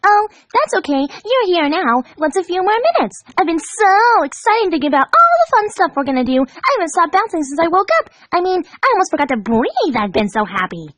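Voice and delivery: high, bright-eyed and soothing; gleefully reassuring. A narrator's reading. Pinkie reassuring late Rainbow Dash, high and gleeful. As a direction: gush reassurance like a giddy friend. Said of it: "responded in a gleefully reassuring tone".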